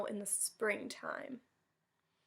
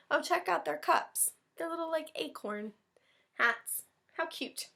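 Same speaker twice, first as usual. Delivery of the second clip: higher, cooing delight; gushing; 6 semitones higher than usual. Real, not acted. Teacher cooing at the picture for the class, higher. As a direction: coo over the tiny cups.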